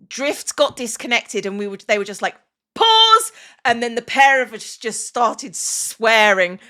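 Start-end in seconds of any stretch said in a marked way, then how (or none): 2.8-3.3 loud, high-pitched shout of interruption; sudden call-out. Retold or imitated. Co-players halting an emotional scene when Drift got disconnected, urgent shout.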